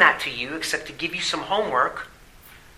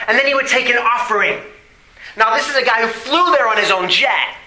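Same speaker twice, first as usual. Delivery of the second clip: higher, fast, scornful preacher; indignant; 8 semitones higher, 4 dB louder, faster than usual. Real, not acted. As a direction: spit out the outrage.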